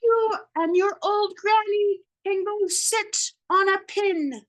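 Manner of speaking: high, loud, slow taunting pig; pig yelling. Retold or imitated. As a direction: yell the insult down at him. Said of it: "the third little pig yelled".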